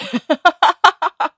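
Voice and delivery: loud high laugh. Real, not acted. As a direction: laugh at the memory. Laughing about childhood meatloaf.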